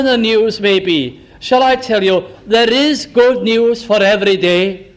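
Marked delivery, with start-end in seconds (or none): none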